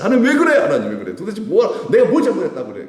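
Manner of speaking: high, loud whiny protest to God; mock complaining whine. Believer grumbling at God, high loud mock complaint.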